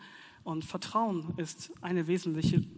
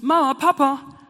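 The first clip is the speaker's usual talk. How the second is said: loud, higher calling out for parents; child calling; 6 semitones higher, 11 dB louder, slower than usual. Retold or imitated. Child in pain calling parents, loud and high. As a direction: call out like a hurt child. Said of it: "Es ruft".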